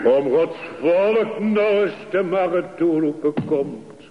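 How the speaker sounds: preacher rousing God's people to listen; impassioned preaching. Acted. Climax of sermon, calling the congregation.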